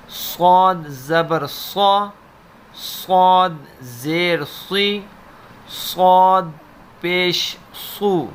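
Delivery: "صا" "صی" "صو" with a thick, heavy s; heavy saad. Shown as a demonstration. Teacher separates saad from seen for students, heavy s. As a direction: say "صاد" full and heavy, unlike seen. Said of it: "سین اور صاد میں ہم نے difference رکھنا ہے".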